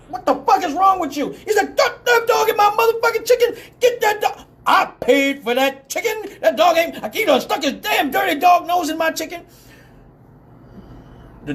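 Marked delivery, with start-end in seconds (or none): 0.1-1.3 high-pitched exasperated cop voice; retold indignant challenge, pitched up; incredulous cop. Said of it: "the cop with the leash is like". 1.8-9.4 high, hollered indignant protest; imitated outraged yelling; indignant ranting. Stinger protests about his chicken.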